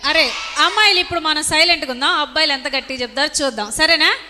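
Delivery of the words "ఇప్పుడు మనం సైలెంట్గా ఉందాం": ordinary talk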